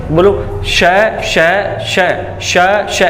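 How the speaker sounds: श said three different ways, hissed air each time; teacher demonstrating the sibilant श; three sh sounds. Shown as a demonstration. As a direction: hiss out each श slightly differently. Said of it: "ये तीनों श अलग-अलग प्रकार के हैं".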